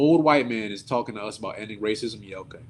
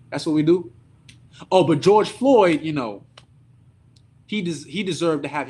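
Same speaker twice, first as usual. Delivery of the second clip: sarcastic; 3 semitones higher, 5 dB louder than usual. Real, not acted. Sarcastic outrage.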